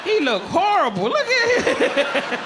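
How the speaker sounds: high, slow, laughing mockery; mocking laugh. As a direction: cackle at him while you say it. Mocking partner to friends.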